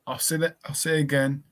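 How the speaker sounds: ordinary delivery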